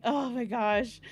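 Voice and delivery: normal talking voice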